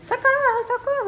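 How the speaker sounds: high-pitched imitation of cries for help; put-on shrieking plea; mock screaming for help. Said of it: "começou a gritar".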